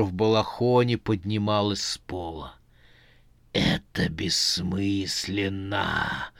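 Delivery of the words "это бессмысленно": low, soft, very slow dread; eerie dread voice. A narrator's reading. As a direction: chill them with a creeping voice. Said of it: "голосом, нотки которого кололи иголочками ужаса".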